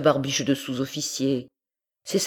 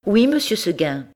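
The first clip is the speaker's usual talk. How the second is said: higher, sweet goat voice; higher little goat; 7 semitones higher than usual. Acted. Goat answers her master, higher and sweet.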